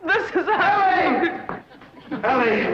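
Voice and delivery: a lovesick man's high gushing call; ecstatic cry. Acted. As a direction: swoon out her name. Smitten man greets his beloved.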